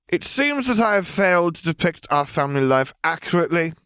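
high, level and unbothered; bored girl voice. A narrator's reading. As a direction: cut in as if nothing matters. Ellie explains deadpan.

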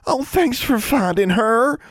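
high, drawn-out gushing; gushing thanks. A narrator's reading. Young witch thanking the toad's finder, gushing. Relieved girl gets toad back.

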